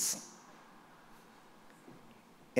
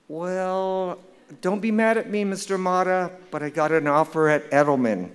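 higher-pitched imitation of a pleading young caller; sheepish student; 5 semitones higher, 3 dB louder than usual. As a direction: plead apologetically in a put-on higher voice, easing down at the end. Student sheepishly breaks news on phone.

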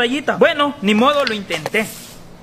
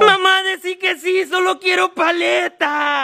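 higher, drawn-out pleading whine; whiny tantrum; 3 semitones higher, slower than usual. Acted. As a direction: beg like a spoiled child. Child's tantrum begging for lollipop. (berrinche suplicante, alargando las palabras)

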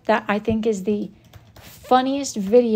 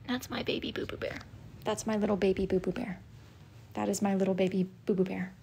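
put-on soft baby talk; 16 dB quieter than usual. Owner softly cooing to her pet. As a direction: murmur lovingly to the pet.

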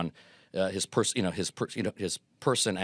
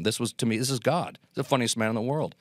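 usual speaking voice

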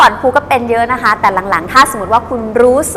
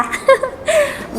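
high little demo laugh; giggle mid-speech. Shown as a demonstration. Teacher acting out the giggly speaker again, high giggle. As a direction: laugh the point off lightly. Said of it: "พูดไปหัวเราะไปค่ะ".

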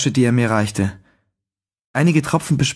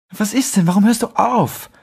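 put-on higher, breathless young woman puzzled; a higher, lightly voiced woman, puzzled; Clara's voice; 9 semitones higher than usual. A narrator's reading. Clara calls to the stopped lover.

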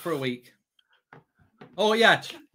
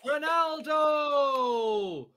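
slow drawn-out shout, higher; long goal yell; 7 semitones higher, much slower than usual. Real, not acted. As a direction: hold the name in a long yell. Goal celebration chant.

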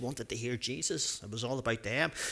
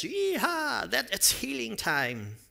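excited cheer; 9 semitones higher, slower than usual. A narrator's reading. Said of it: "yelled his friends".

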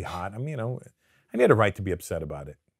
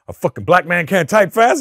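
indignant yell, higher and louder; imitating Faison's furious retort, much louder; angry outburst; 8 semitones higher, 11 dB louder than usual. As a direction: shout it back, insulted. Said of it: "He got really mad".

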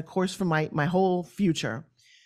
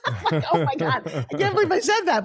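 much higher, laughing through the words; 8 semitones higher than usual. Real, not acted. Storyteller amused by her own rude comeback, laughing. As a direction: crack up in disbelief at yourself.

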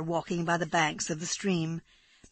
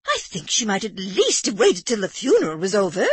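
louder, shocked lady; prim society lady, scandalised; 3 semitones higher, 7 dB louder than usual. A narrator's reading. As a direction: say it as if deeply offended by bad manners. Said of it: "in a scandalised voice".

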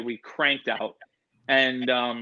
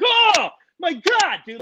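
high, drawn-out shriek of shock; startled cry; 5 dB louder, slower than usual. Retold or imitated. Telling of a gecko lunging at him, startled cry. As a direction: re-enact jumping out of your skin, loud and high.

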